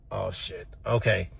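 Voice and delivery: very low, soft, sinking reaction; quiet dread. Retold or imitated. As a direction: say it small and low, caught out. Dread at news of conference.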